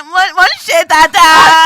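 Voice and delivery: shouting it out in Yoruba; big loud proud Yoruba line. Acted. Guest shouting her Yoruba line to the host, loud.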